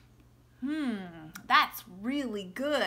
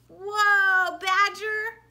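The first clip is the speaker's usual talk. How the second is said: excited; 6 semitones higher, 7 dB louder, much slower than usual. Acted. Reading Badger's excited greeting.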